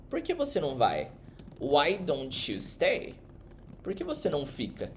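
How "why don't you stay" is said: "Why don't you stay" stretched slowly; slow model. Shown as a demonstration. Teacher modelling a suggestion, drawn out slowly. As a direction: spell it out slowly. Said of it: "você vai dizer".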